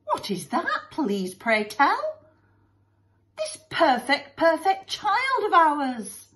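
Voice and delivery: gushing, drawn-out, high-pitched endearments; doting couple. Acted. Lovey-dovey parents praise their child, gushing. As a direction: coo adoringly over a perfect child.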